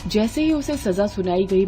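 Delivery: ordinary delivery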